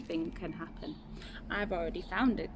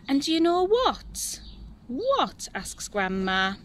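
higher, slow, thrilled reveal; excited secret; 6 semitones higher, 3 dB louder than usual. Acted. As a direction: savour the big surprise.

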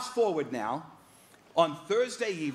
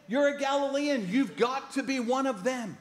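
much higher, amused gotcha; accusing crowd; 8 semitones higher than usual. Retold or imitated. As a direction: catch him out by his accent. Crowd recognises Peter's accent.

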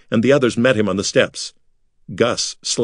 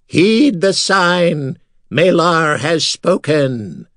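very slow, high, eerie proclamation; eerie skull voice; 8 semitones higher, 3 dB louder, much slower than usual. Acted. The skull warning the priests, very slow and high. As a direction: draw it out like a ghostly oracle. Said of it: "in an eerie voice".

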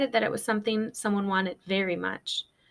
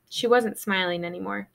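usual speaking voice

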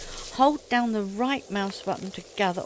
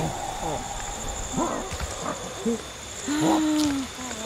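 scared gasp; 5 semitones higher, 4 dB quieter than usual. Real, not acted. Sudden scare in the game.

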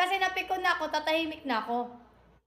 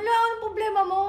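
put-on boyfriend snapping back, voice raised; 6 semitones higher, 5 dB louder, faster than usual. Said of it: "tumaas yung boses niya".

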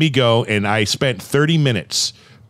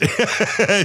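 a quick bright laugh, pitched way up; short high laugh; 11 semitones higher than usual. Real, not acted. Host laughing at his own Tesla joke, short high laugh.

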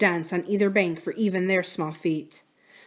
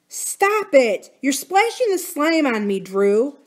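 annoyed; 7 semitones higher, 4 dB louder, slower than usual. A narrator's reading. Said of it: "Buki said".

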